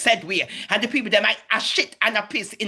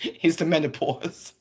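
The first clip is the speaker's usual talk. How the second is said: 1 laughing; 4 semitones lower, 4 dB quieter than usual. Real, not acted. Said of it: "I'm getting emotional".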